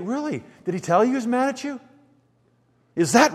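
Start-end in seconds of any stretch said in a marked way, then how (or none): 0.0-1.8 higher, lightly incredulous questioning; mock disbelief. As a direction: question it as if you can't believe it. Challenging an imagined believer's claim.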